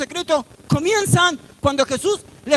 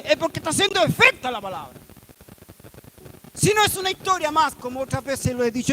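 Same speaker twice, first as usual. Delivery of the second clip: ordinary delivery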